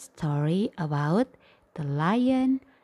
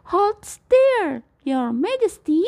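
high fast hushed squeak; squeaky mouse; 10 semitones higher, 4 dB louder than usual. Acted. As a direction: urge the lion to keep still. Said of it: "squeaked the mouse".

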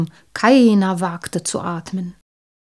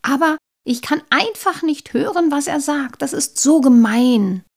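whining; 6 semitones higher than usual. Acted. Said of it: "jammerte sie weiter".

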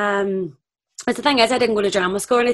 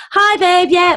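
imitating a chirpy caller, high and loud; breezy phone greeting; 10 semitones higher, 8 dB louder, much slower than usual. Casting woman answering her phone mid-audition, loudly breezy.

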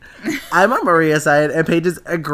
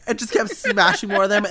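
ordinary delivery

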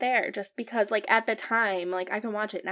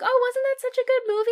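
high, fast, louder mock gushing; gushing moviegoer; 14 semitones higher, 6 dB louder, faster than usual. Moviegoers gushing to her about the film, mock gushing. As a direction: mimic everyone raving in a high voice. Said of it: "everyone's like".

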